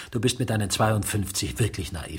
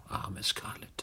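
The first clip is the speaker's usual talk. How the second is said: breathy murmured sigh for Scarlett; barely voiced, grieving under the breath; whispered pity; 3 semitones lower, 11 dB quieter, slower than usual. Mourning whisper over dead woman. (leise geflüstertes Bedauern)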